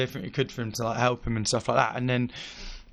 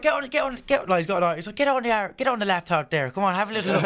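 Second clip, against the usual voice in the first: high-pitched, put-on uncle's accent; 7 semitones higher, faster than usual. Uncle pushing nephew to book the trip, imitated accent. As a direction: urge him on in a higher accented voice. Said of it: "Good accent".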